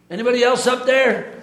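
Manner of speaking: high loud call upward. Acted. Bob on the branch calling up for another answer. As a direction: shout up hoping for someone else.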